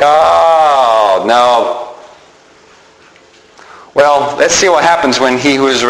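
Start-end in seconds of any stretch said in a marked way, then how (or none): 0.0-1.8 long drawn-out mock "oh", sinking into "no"; imitated hopeless dismay. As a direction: mimic a crowd's dismayed groan. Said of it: "he's seeing this hopelessness around him".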